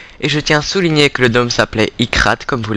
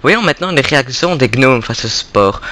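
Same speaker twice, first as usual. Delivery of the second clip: ordinary talk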